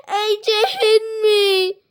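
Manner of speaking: high tearful wail of blame; crying yell. A narrator's reading. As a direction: whine it through tears. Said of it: "she yelled".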